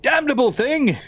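high, exclaimed mock outrage; hammed-up indignant cry; in-character cursing. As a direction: curse it theatrically as it is thrown. In character, throwing the owl away.

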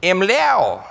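pleased, high mock-confident em lao; proud reply. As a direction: answer eagerly, sure you got the word right. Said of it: "And so I said".

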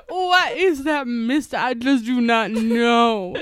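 hammed-up Southern accent, slow ending. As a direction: echo a baffled Southerner with a long drawl. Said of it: "Why is the Southern accent".

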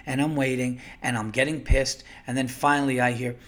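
ordinary talk

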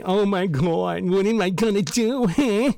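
high, whiny, slow-starting mock wail; mocking whiny victim. Mocking a privileged worker who got yelled at, high whiny mock voice. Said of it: "I hate saying it like that, and I know that sounds so condescending".

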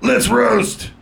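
high, announcer-style cry; host's declaration. Acted. Comedy club host opening the roast battle with a high call. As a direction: kick off the roast like a hype host. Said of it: "Brian Moses eating an eclair and declares".

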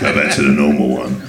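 low laughing grumble; gruff, lazily fed up; a hint of a bored action star; Statham giving up. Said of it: "literally just gives up on it".

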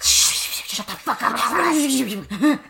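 very slow, stretched out; drawn out hush. Retold or imitated. Girls hushing a friend, very slow drawn hush. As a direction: stretch every word out. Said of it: "everyone's like".

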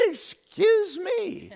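slow, drawn-out high disbelief; shocked excuse me. Retold or imitated. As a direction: stretch it out in stunned disbelief. Shocked reply to accusation.

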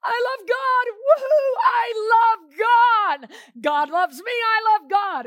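shouting with joy, high and loud; joyful shout. Pretend. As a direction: cry out your love in triumph. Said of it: "on the inside, you're going".

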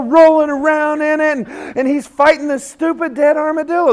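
ordinary delivery

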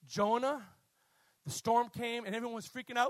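normal talking voice

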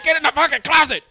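loud, high barked order; mock yell. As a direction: bark it like a panicked cop. Pacino shoving her in closet.

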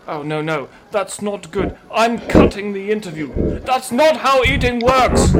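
rising exasperated outburst. Acted. Anchor loses patience.

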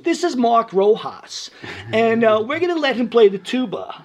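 teacher voice. Retold or imitated. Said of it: "She goes".

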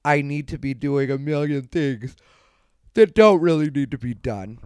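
talking through a yawn; words stretched by a big yawn. Real, not acted. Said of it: "I'm sorry I'm yawning".

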